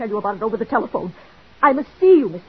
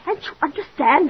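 higher and louder, near breaking; desperate outburst; 10 semitones higher, 5 dB louder than usual. Acted. Mrs Bradley losing patience with Mr Rogers, desperate cry. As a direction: burst out in frustration.

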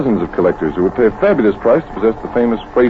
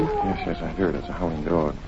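hushed; 6 semitones lower, 7 dB quieter than usual. Acted. Listening in the dark swamp.

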